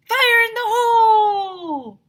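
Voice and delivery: slow warning yell before the blast; Mister Burke yelling before the blast. A narrator's reading. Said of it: "Then he yelled".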